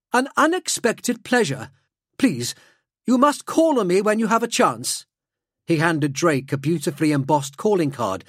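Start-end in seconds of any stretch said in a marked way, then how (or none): 0.1-5.1 high-pitched, courteous nobleman; Helmont Corval. Acted. Nobleman inviting Drake to call on him, high and gracious. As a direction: extend a formal invitation in a high aristocratic voice. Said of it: "replied Corval".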